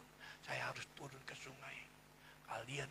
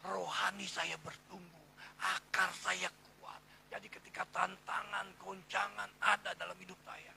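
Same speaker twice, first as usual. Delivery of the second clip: emphatic preaching; 3 semitones higher, 8 dB louder than usual. Real, not acted. Preacher testifies to congregation about firm roots, emphatic preaching. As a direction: preach it out with force.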